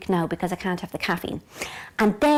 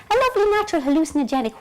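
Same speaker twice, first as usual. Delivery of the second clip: high, loud, fast mock glee; gleeful; 6 semitones higher, 7 dB louder, faster than usual. Narrator relishing a big spoonful, gleeful retold thought. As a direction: rush it out with relish.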